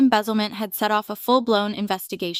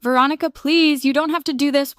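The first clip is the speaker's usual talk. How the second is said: higher, louder desperate plea; pleading; 6 semitones higher, 5 dB louder than usual. Acted. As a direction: beg her to stop, voice rising. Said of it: "Cassandra's voice suddenly came through".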